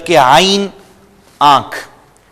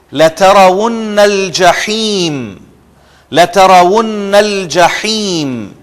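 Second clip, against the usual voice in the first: slow, higher recitation with a throaty ح; Quranic recitation; 4 semitones higher than usual. Shown as a demonstration. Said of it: "ہا کو آپ underline کر لیں".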